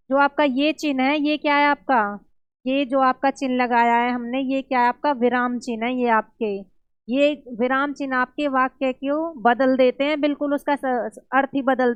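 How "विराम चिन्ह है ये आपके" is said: normal talking voice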